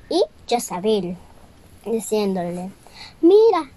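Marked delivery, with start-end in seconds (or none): 3.2-3.7 higher, louder call; high call of mira; fairy calling. Acted. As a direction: call her attention, pitched up. Fairy explains to Cinderella.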